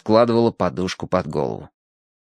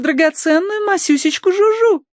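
put-on high, cooing baby talk; cutesy baby talk. A narrator's reading. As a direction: coo like a doting lady. Tossie coos over her dog.

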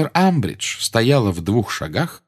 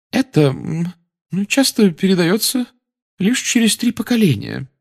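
high, hesitant mystic seer; flustered fortune teller; Trelawney voice; 11 semitones higher than usual. A narrator's reading.

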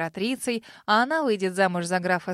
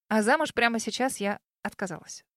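usual speaking voice

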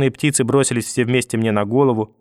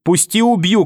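much higher, louder scream; grandma screams to be let go, much higher and louder; 7 semitones higher, 3 dB louder, slower than usual. A narrator's reading. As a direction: scream it as a threat from the dark. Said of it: "кричала где-то в темноте бабушка".

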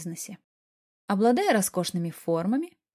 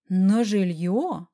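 very slow, drawn-out astonished question; gasped shock; 4 dB louder, much slower than usual. A narrator's reading. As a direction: stretch it in disbelief. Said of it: "ахнула я". (поражённо ахнуть)

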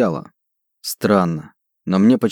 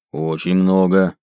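slow greedy orc, broken words; drawn-out low orc voice; broken Dwarvish; 3 semitones lower, slower than usual. Acted. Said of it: "на ломаном языке гномов".

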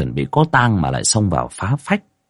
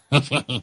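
short, softer tittering laugh; giggling chuckle; 5 semitones higher, 6 dB quieter than usual. A narrator's reading. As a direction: snicker briefly under the breath. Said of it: "cười hì hì".

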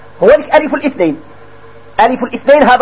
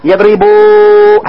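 يضربوا drawn out and louder on وا; slow stressed example; 2 semitones lower, 4 dB louder, much slower than usual. Shown as a demonstration. Teacher pointing out the waw, slow and loud. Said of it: "هو ذيك الواو".

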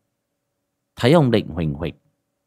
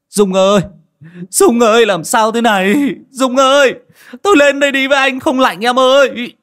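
much higher, very loud wailing cry; desperate shout; 8 dB louder than usual. Acted. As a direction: cry out for his wife in panic. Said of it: "Anh Mẹo gào lên". (gào lên gọi vợ, rất to, cao)

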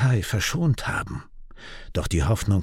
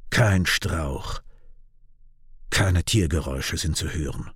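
usual speaking voice

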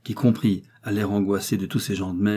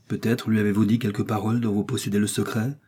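usual speaking voice

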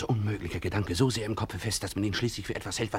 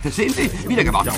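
high, loud gloating; triumphant; 10 semitones higher, 7 dB louder than usual. Acted. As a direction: crow about the win. Triumphant gambler.

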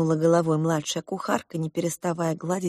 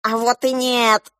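raised, higher parrot cry; 6 semitones higher, 4 dB louder, slower than usual. A narrator's reading. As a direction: call out a cheeky contradiction. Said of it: "закричали попугаи". (крик попугаев)

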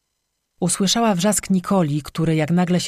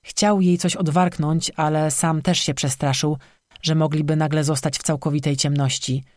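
plain reading voice

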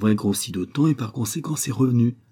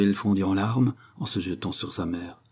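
ordinary delivery, nothing marked

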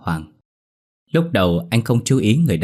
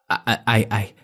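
stammering in fear; 2 semitones higher, 4 dB louder than usual. A narrator's reading. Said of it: "ú ớ nói không ra tiếng".

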